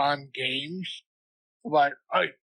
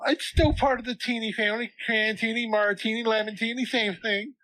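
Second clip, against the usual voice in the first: imitating them; 7 semitones higher than usual. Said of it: "And they're like".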